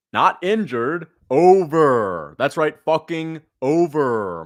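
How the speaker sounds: loud ringside hype yell; loud victory bellow, slowed on the last words; hype shout. Acted. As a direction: roar it like a fighter who just won. Hype shout after knockout.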